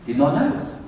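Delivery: normal talking voice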